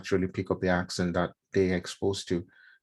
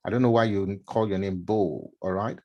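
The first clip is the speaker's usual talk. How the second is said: ordinary talk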